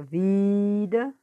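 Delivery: "vida" with a long, loud VI; teacher drawing out VI in "vida"; stretched stressed syllable. Shown as a demonstration. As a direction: hold the "vi" in "vida". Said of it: "puxando mais a sílaba forte".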